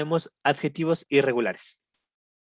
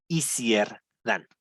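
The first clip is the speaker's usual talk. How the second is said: Easier than, stretched slowly; slow model reading; 3 semitones lower, much slower than usual. Shown as a demonstration. Teacher slowly models easier than for the class. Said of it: "teacher models the comparative".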